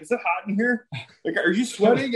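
mock panicky. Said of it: "He's like".

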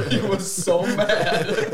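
loud, high, drawn out through laughter; laughing. Real, not acted. Telling friends how the brother's hunt went wrong, laughing hard. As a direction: crack up while saying it, real laugh.

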